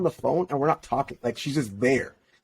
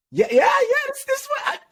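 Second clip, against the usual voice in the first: incredulous exclaim. Retold or imitated. Disbelieving recall of prom scene.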